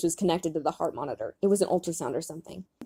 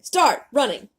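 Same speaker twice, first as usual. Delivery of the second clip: raised, brief go order; called-out command; 5 semitones higher, 5 dB louder, slower than usual. Acted. Leader directs falling teammates, calling out. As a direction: call out the restart.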